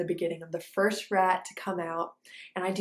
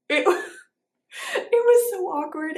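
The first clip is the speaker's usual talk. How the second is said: real embarrassed laugh breaking through, higher and louder; 12 semitones higher, 7 dB louder than usual. Real, not acted. Recalling fingers in his mouth, laughing in embarrassment. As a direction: laugh out the awkwardness.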